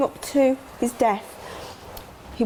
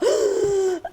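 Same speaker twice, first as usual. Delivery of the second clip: sharp high gasp of alarm, replayed; high retold gasp; 12 semitones higher than usual. Mother recalls seeing news about her son, sharp high gasp. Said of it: "I'm like".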